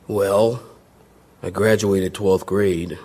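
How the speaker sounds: imitating his once-ashamed answer; sheepish. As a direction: drag the words out like an awkward confession. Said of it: "I used to sheepishly almost say".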